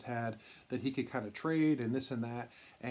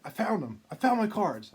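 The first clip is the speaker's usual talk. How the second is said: higher and louder, bursting with the news; excited announcement; 8 semitones higher, 6 dB louder than usual. Retold or imitated. Jim telling Drew he found his boyhood cards, thrilled and loud. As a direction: blurt out the good news, thrilled.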